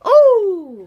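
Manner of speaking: loud, thrilled ooh at a good pull; excited ooh. Real, not acted. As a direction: yell out in delight. Pack opening, good player pulled.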